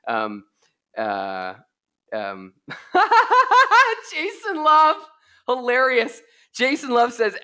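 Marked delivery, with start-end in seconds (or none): 2.7-4.1 sudden high laugh at a viewer's joke; high burst of laughter. Real, not acted. Host laughing at Jason Love's comment, loud high laugh.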